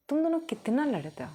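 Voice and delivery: put-on high scolding voice of the girls' monitor. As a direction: scold the two fighting boys. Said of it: "वो कहती है".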